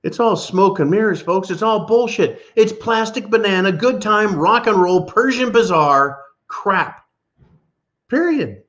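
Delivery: heated rant. Real, not acted. Host ranting to his audience about political spin, heated and high.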